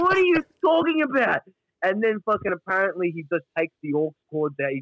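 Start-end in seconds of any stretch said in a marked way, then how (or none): 0.0-1.4 much higher, mock disbelief; high disbelieving mock question; high incredulous squeak. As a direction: squeak it out, baffled. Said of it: "I'm like".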